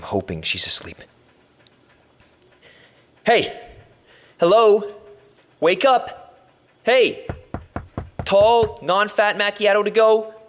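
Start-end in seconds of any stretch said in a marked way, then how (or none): 3.2-10.3 loud calls across the glass, high and strained; shouting to wake her. Acted. Captive yelling through glass to wake the woman, loud. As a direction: yell to rouse the sleeping woman.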